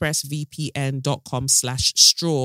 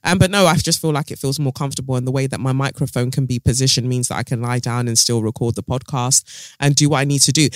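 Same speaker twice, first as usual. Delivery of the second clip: ordinary talk, nothing marked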